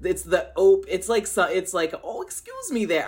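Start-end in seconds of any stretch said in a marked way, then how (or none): 2.0-3.0 higher, softer Midwestern politeness; imitated Midwesterner, soft and raised; Midwestern accent. Shown as a demonstration. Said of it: "no, that's real. It's like".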